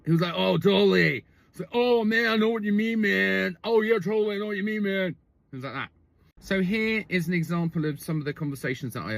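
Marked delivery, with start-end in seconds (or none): 0.4-1.2 frat bro. Retold or imitated. Comedian mimicking a boyfriend on the beach, frat-bro voice. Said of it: "like, a sort of a frat bro". 1.7-5.2 high, droning mock-bro voice; frat bro. Comedian showing how the boyfriend talks, frat-bro voice. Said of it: "like, a sort of a frat bro".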